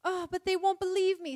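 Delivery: put-on high, loud storybook girl's protest; acted plaintive outburst, pitched high; protesting Lucy. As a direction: object anxiously as a storybook girl.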